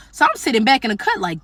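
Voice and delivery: ordinary talk, nothing marked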